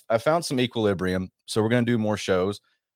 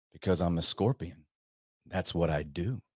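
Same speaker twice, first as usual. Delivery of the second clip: a low, soft, unbothered scorpion; quiet, matter-of-fact scorpion; 4 semitones lower, 7 dB quieter than usual. Acted. Scorpion tells the drowning frog, soft and unrepentant. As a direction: let it drop softly, no remorse.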